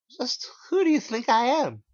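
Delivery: very high, loud anxious demand; shrill frightened question; alarmed. Acted. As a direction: press him nervously. Narrator confronting cashier.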